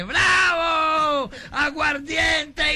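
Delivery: high, loud, drawn-out cheer; joyful shout. Acted. Drunk cheers that he pees liquor, overjoyed shout. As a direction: cheer like you struck gold.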